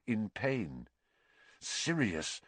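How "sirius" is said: muttered. A narrator's reading. Harry hears a dog in pain, muttering. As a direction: mutter it under your breath. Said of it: "Harry muttered".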